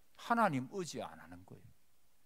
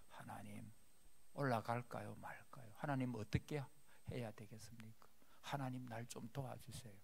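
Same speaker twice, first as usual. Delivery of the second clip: 속삭이듯 아주 작게 묻는 기도; 조용히 하나님께 여쭙듯; hushed prayer; 2 semitones lower, 10 dB quieter than usual. Acted. David quietly asking God. (다윗이 하나님께 조용히 여쭙듯)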